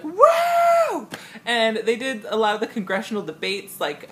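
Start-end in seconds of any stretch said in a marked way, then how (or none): 0.0-1.1 high, loud crowd shriek; long mock whoop; whooping audience. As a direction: imitate a crowd going wild. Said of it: "the audience was like".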